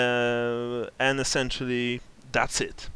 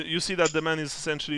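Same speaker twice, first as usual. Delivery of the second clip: normal talking voice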